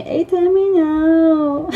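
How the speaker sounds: put-on high, slow whiny child voice. Spanish child whining that they have finished. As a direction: whine it out like a tired Spanish kid. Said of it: "that kind of whiny voice".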